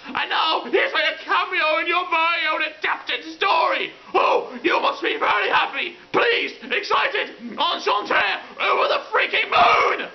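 sarcastic screaming tirade; furious rant. Acted. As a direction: yell accusations in comic outrage. Acted quarrel with the creator.

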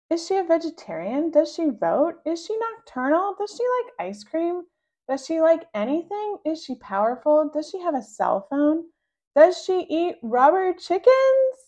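high, loud pestering questions; excited child pestering her siblings; rapid eager questions. Acted. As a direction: fire questions at them, too excited to stop. Rascal eagerly quizzing siblings.

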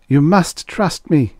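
high, drawn-out appeal; urgent plea. A narrator's reading. Man pleads with the armed woman, high and urgent.

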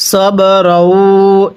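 সবরও recited very slowly, vowel drawn out; slow recitation. Shown as a demonstration. Teacher models সবরও for students, very slowly. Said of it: "কিভাবে পড়তে হবে?".

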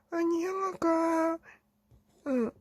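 put-on high, slow kid's whisper; little kid whispering to dad about his brother. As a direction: whisper it like a timid small child. Younger Teletubby tattles softly.

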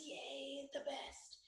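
tiny whispered yay; whispered cheer. Real, not acted. Teacher introducing Hot Cross Buns, whispered cheer. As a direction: cheer under your breath, barely audible. Hushed cheer for the next song.

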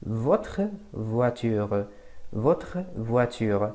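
Votre voiture spoken very slowly, each sound clear; slow model French. Shown as a demonstration. Teacher slowly modelling Votre voiture for the class. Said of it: "شو بقول؟".

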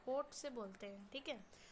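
normal talking voice

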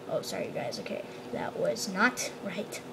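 hushed, embarrassed apology; sheepish whisper. Real, not acted. After yelling into the mic, a sheepish whisper. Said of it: "I just seriously probably broke your ears".

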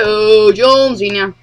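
ordinary talk

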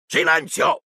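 uncle's angry yell; yelled order. A narrator's reading. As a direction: yell for silence. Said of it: "urlò zio Vernon".

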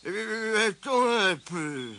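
slow, stretched-out hesitation; pondering. A narrator's reading. Mole trying to remember for Tirondin, pondering. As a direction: draw the words out while thinking. Mole searches his memory.

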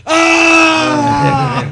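long, high, loud yell of agony; pained scream. Retold or imitated. Injured wrestler whose arm gets yanked, long pained scream. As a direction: imitate a drawn-out howl of pain.

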